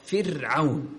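فرعون with a thin, light ra. Shown as a demonstration. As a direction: model فرعون, keeping the ra light. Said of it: "رقق الراء".

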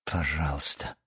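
low, soft grumble; gloomy, begrudging reply; sullen grunt. A narrator's reading. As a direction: mutter it grudgingly. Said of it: "хмуро буркнула Алиса".

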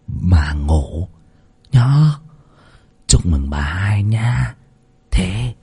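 low, hushed conspiratorial whisper. A narrator's reading. Eavesdropping man whispers to his mistress, hushed whisper. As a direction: lean in and breathe it secretly. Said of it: "mà thì thào".

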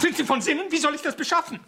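high, loud outrage; outraged. Acted. Writer protests the extra blackmail, high and loud. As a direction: fling the questions back.

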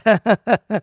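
short, high jeering laugh; mocking laugh. A narrator's reading. Beaten Makar laughs at his attackers, mockingly. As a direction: laugh in their faces.

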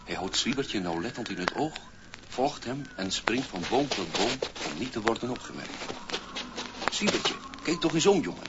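ordinary delivery

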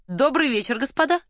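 lively, lilting hello that lifts high; cheerful greeting. A narrator's reading. Varya greeting her acquaintances, cheerful and lifted. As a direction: greet them gaily, rising at the end. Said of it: "весело приветствовала знакомых Варя".

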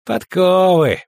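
loud, high, grudging reply; irritable one-word retort; grumbled answer. A narrator's reading. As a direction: answer the inspection crossly. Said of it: "проворчал Паулье Дальберг".

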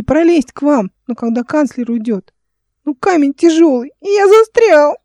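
put-on high, loud, wailing child; high-pitched boyish lament; distressed little boy. A narrator's reading. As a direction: explain tearfully, building to a wail. Upset stuck boy voiced in story.

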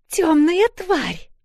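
slow high shriek; shrieking woman. A narrator's reading. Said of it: "визжит какая-то женщина".

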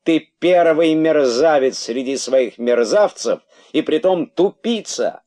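высокий, громкий, дерзкий голос; бросай оскорбления дерзко и звонко; дерзкий выкрик. A narrator's reading. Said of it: "воскликнул дерзкий кролик". (дерзко, на повышенных тонах)

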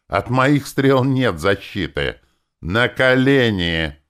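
loud, high, slow domineering shout; a gloating tyrant's order; triumphant command. Acted. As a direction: roar it from above.

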